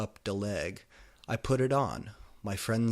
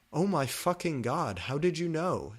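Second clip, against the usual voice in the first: high, amazed outburst; gasping amazement; 7 semitones higher than usual. A narrator's reading. Goth boy amazed she knows his band, high and gasping. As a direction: gasp in delight. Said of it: "Satan gasped".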